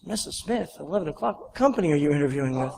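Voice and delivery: imitating a quietly puzzled receptionist; softly puzzled. Receptionist checking a visitor's appointment, softly puzzled. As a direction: query it under your breath, mildly confused.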